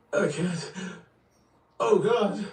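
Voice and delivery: high, loud terrified cry. Acted. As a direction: break into a cry of terror. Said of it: "She coughed, gasped".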